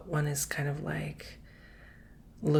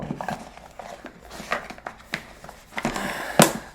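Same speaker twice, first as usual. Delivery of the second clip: long, breathy exhale; heavy sigh. Real, not acted. Reader worn out by the energy work, long heavy sigh. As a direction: let out a drained, winded sigh. Winded and drained by the reading.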